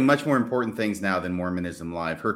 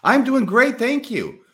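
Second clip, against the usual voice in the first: excited; 12 semitones higher, 9 dB louder, much slower than usual. Real, not acted. Cheery reply to greeting.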